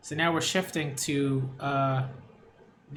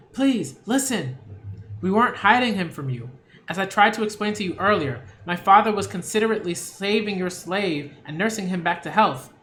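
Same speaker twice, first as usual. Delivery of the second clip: put-on higher, louder, fast pleading girl; young daughter pleading; 4 semitones higher, 4 dB louder than usual. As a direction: plead with your father sweetly but urgently.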